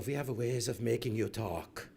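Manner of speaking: German war-movie villain, softly done; movie German accent. Retold or imitated. German in old war movies interrogating, mock German accent. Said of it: "that's how the German talks".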